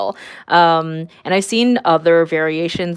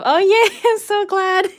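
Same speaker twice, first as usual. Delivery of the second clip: high, loud, giggling delight; delighted squeal; 14 semitones higher, 5 dB louder than usual. Real, not acted. Streamer thanking a viewer who loves the drawing, squealing with delight. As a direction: burst out happily, laughing through it.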